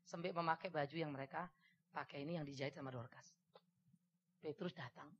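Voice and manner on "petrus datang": usual speaking voice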